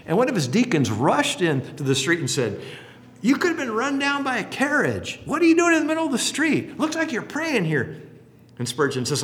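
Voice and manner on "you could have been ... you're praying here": much higher, fast worried deacon; alarmed deacon. Retold or imitated. Deacon scolding Spurgeon in the street, high alarmed voice. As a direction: rush out and scold him in alarm.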